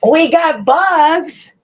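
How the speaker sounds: high, slow excited echo; grandma echoing her grandson. Real, not acted. Echoes toddler, high and slow.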